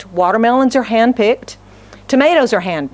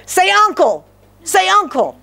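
high-pitched mock taunt; bullying brother; 8 semitones higher than usual. Brother pinning his brother down, high mock demand. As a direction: demand surrender in a raised, playground pitch.

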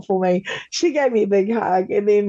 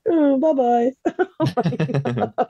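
dismissive goodbye; 4 semitones higher, much slower than usual. Retold or imitated. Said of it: "you're like".

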